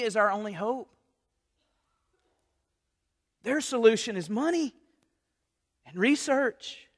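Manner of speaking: high, forceful pulpit voice; fervent preaching. Acted. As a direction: preach it hard to the congregation.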